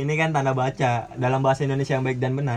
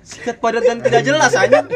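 high, laughing punchline; laughing at a vague answer; laughing joke; 8 semitones higher, 6 dB louder than usual. Real, not acted. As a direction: crack the joke while laughing. Laughing twist on a phrase.

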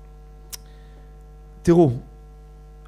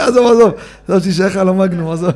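louder, brushing it off while laughing; laughing dismissal; 4 dB louder, faster than usual. Real, not acted. Rabbi brushing off the gematria question, laughing. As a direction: wave it away laughing.

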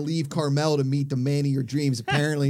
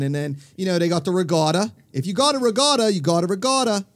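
put-on broad Pittsburgh accent, pitched up and louder; 4 semitones higher, 4 dB louder than usual. Local bragging about the regatta, hammed-up Pittsburgh accent. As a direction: play up the Pittsburgh vowels with gusto. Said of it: "Amanda hates your hometown accent".

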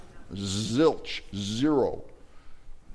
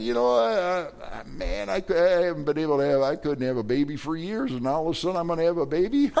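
higher, stammering disbelief, rushing at the end; disbelieving Sarah; 6 semitones higher than usual. Acted. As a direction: stumble over words then blurt out the doubt. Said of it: "He said".

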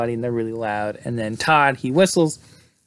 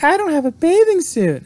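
squeaky high-pitched mock girl, louder; high girly voice; 6 dB louder than usual. Friend mocks the nervous girl at the lake in a high squeaky voice. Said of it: "she's like".

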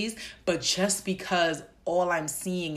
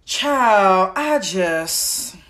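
a burst of loud exasperation; loud, exasperated outburst at the anxiety; exasperated exclamation; 3 semitones higher, 9 dB louder, much slower than usual. Real, not acted. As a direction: blurt it out, overwhelmed. Overwhelmed exclamation.

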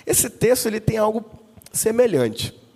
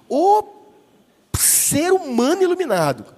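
exalted proclamation; 8 semitones higher than usual. Real, not acted. Preacher exalting mothers.

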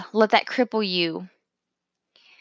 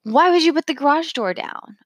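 higher, sharp retold snap at her husband; snapping; 6 semitones higher than usual. Wife retelling snapping at husband over garage door, irritated. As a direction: bite the question off irritated. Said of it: "snapped at him and was like".

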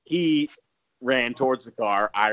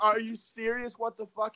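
high incredulous; 12 semitones higher, 4 dB quieter than usual. Retold or imitated. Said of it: "as I'm saying".

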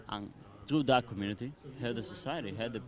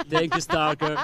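thanks through a real laugh, louder; 6 semitones higher, 6 dB louder than usual. Real, not acted. Laughing as he thanks his co-host.

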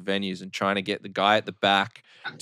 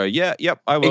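eager agreement; 7 semitones higher, 5 dB louder than usual. Retold or imitated. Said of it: "energy where people go".

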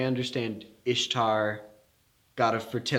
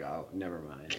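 backing off; 6 semitones lower, 10 dB quieter than usual. Retold or imitated. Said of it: "He was like".

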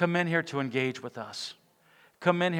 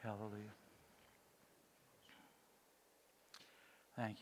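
soft, prayerful ministry voice, much quieter; hushed altar call; 3 semitones lower, 5 dB quieter than usual. Acted. Pastor calls the standing church to choose Jesus, hushed and prayerful. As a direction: gently draw the standing congregation toward Jesus.

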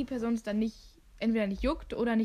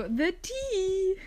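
gossip glee; 8 semitones higher, 3 dB louder than usual. Retold or imitated. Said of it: "Und du bist so".

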